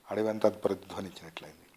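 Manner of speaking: ordinary delivery, nothing marked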